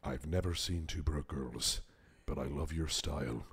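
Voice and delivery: very low, hushed action-star impression; deep and soft, long pauses between phrases; Liam Neeson. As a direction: drop way down into a quiet, measured menace. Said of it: "That was my Liam Neeson impression".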